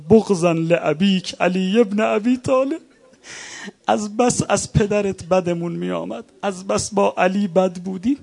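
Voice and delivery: enemy's shout. Retold or imitated. Enemy soldier shouting his hatred at the imam, loud. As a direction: hurl it at him with contempt. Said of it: "صدا زد".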